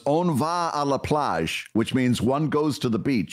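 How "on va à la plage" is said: slow, careful schoolboy French on "On va à la plage"; schoolbook French. Shown as a demonstration. Recalling the one French phrase from school, said slowly. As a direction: sound out "On va à la plage" like a high school lesson. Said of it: "all I remember from my high school French class".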